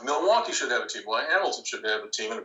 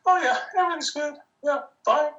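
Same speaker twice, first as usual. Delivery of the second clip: strained high-pitched reassurance, much higher and louder; voice up an octave; 4 dB louder than usual. Retold or imitated. Stressed team owner insisting to friends he is fine, voice cracking high. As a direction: squeak out a nervous fine. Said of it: "your voice might go up an octave".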